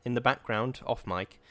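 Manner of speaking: ordinary delivery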